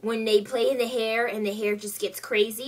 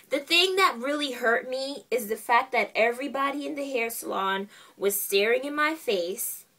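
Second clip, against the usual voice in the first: ordinary talk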